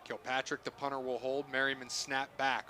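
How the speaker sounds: ordinary delivery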